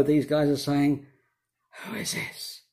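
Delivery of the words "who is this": hushed, voiceless whisper; barely audible, acting out stunned wonder; awed whisper. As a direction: whisper it in retold astonishment. Retelling the disciples' stunned question.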